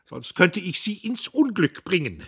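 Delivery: very high, loud screeching threat; loud screeching old man; furious shriek. A narrator's reading. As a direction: shriek it in tearful rage. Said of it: "Er kreischte".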